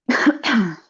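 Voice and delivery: a real short cough. Real, not acted. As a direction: cough after swallowing. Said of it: "Excuse me".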